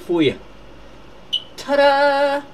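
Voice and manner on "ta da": high, loud, drawn-out ta-da; ta-da fanfare. Pretend. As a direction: sing out a showy reveal. Presents a little birthday present.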